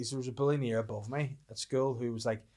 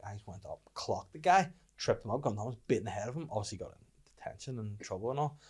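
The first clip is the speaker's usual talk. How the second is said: ordinary talk, nothing marked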